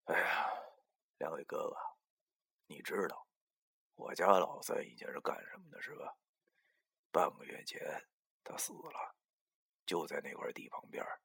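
quiet, low, halting disclosure; downcast, hushed and hesitant; dejected confession. A narrator's reading. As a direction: let the news out heavily, fading to a whisper. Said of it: "垂着个脑袋对两个神棍说".

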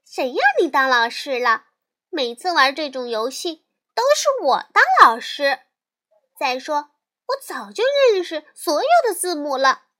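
loud and indignant, speeding up at the end; little sister protesting loudly at her friend. Acted. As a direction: push back hard, she won't be the pupil. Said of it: "小熊妹妹抗议道".

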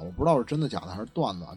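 normal talking voice

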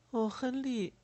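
put-on high-pitched little child's voice; young child. A narrator's reading. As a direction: pipe up as a small child. Child character speaks to Lémor. (稚嫩高音的童声)

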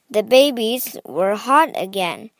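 plain reading voice

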